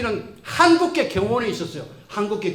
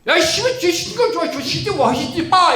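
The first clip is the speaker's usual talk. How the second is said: put-on high, loud slurred swearing teen; mock delinquent cursing at his teacher; cursing delinquent kid; 7 semitones higher, 6 dB louder than usual. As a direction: spit it out like a rude mumbling kid. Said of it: "그 아이 했던 말을 그대로 옮길게요".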